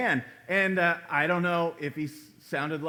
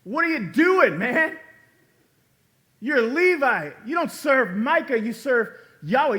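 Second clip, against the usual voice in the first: loud, high mock rebuke; raised, indignant mock scolding; 8 semitones higher, 8 dB louder than usual. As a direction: scold him hard, like a prophet calling out a wayward priest. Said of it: "here's what they should have said to him".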